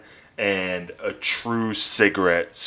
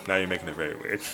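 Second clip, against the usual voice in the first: softer, mumbled repeat; quiet aside; 2 semitones lower, 8 dB quieter, much faster than usual. Real, not acted. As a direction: drop it to a muttered aside.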